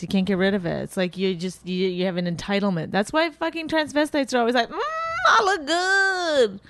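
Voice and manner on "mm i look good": slow, high, drawn-out self-admiring croon; hammed-up diva purr; transvestite impression. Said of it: "that's my transvestite voice".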